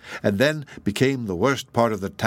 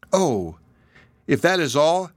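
light, higher, breezy reassurance; lightly and gaily; 5 semitones higher than usual. Acted. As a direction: brush off his fear cheerfully. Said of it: "had spoken lightly and gaily".

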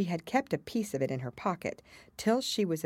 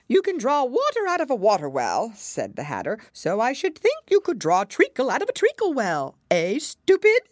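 high, loud mocking Hatter; scornful Hatter; 8 semitones higher, 8 dB louder than usual. A narrator's reading. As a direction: sneer like the Mad Hatter. Hatter mocks Alice.

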